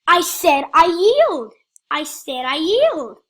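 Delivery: loud, slow, insistent shout; frustrated shout. Real, not acted. Gamer repeating his surrender to other players, slow frustrated shout. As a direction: hammer it home, you already said it.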